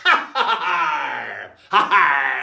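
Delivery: loud hearty ha ha; boisterous pirate laugh; proud pirate laugh. Shown as a demonstration. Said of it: "And you laugh proud".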